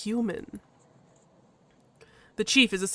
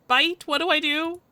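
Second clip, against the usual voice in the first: higher, louder worried question; nervous protagonist; 7 semitones higher, 5 dB louder than usual. Acted. Player asks for help before the chief, nervous. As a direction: ask urgently for help.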